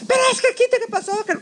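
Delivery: very high, spluttering mock panic; hysterical brother. Brother panicking over the phone, imitated hysteria. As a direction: babble shrilly, tailing off. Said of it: "Pues histérico".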